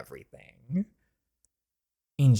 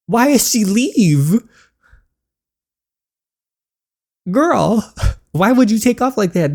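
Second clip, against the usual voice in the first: much higher and louder, an outcry; excited disbelief; 12 semitones higher, 11 dB louder, slower than usual. Acted. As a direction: call after her in loud disbelief. Worried disbelief at girl running.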